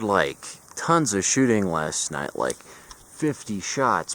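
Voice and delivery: ordinary talk, nothing marked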